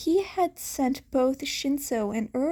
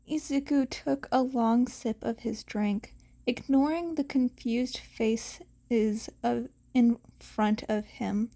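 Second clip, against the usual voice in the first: plain reading voice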